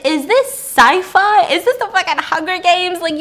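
incredulous questioning. Real, not acted. Podcaster questioning a baffling film plot, incredulous. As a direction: pile up questions in lively disbelief.

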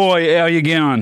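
slow, lightly put-on Australian greeting; a playful Aussie "how you going"; mock Australian accent done on a friend's dare. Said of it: "Do Australian".

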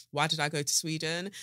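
ordinary talk

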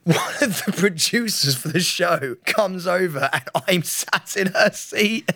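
usual speaking voice

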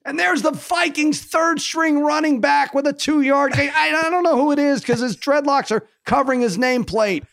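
mock a TV football announcer calling a tiny gain; Tessitore impression. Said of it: "How about Tessitore with this game?".

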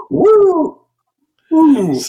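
loud high woo; wrestling woo, high and loud; Ric Flair woo. Acted. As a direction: whoop like a wrestler. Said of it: "I just get excited".

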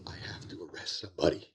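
secretive whisper. Retold or imitated. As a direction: lean in and whisper the confession. Whispered secret to new partners.

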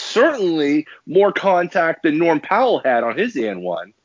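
ordinary talk

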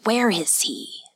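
hushed, whispery lord's voice; whispered demand from a master; cold lord. A narrator's reading. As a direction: question her in a cold near-whisper. Yasutoki sharply questions frightened servant.

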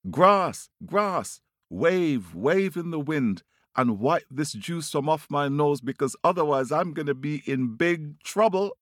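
high, pleading storybook bird voice; calling out. A narrator's reading. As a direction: call to the grass in a high voice. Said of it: "he calls out to the grass".

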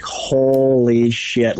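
very slow, drawn-out amazement; stunned, lingering on each word; awed disbelief. Retold or imitated. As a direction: stretch the swear out in awe. Awed at the puffed-out buck.

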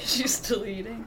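laughing breathy whisper. Real, not acted. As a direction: whisper the joke under your breath. Whispered joke aside.

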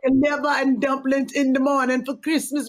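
put-on lilting Caribbean island accent. Co-host reading an island viewer's comment aloud, Caribbean accent. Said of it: "if I read that in that accent".

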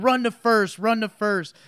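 high, pushy call to run; hammed-up order to run; mock yelling pitcher. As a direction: bark it like an angry pitcher.

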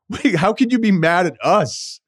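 high, slowed, disbelieving mock voice; incredulous. Friends baffled she is mad at them, high incredulous.

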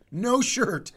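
ordinary delivery

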